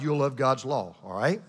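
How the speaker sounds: ordinary talk, nothing marked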